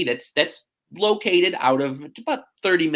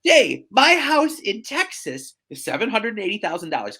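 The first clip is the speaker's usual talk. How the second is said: lightly imitated Southern client, higher voice; Southern twang; 6 semitones higher, 4 dB louder than usual. Client objecting to his realtor, light Southern twang. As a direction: raise the pitch with a hint of twang. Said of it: "he's got this thick Southern twang".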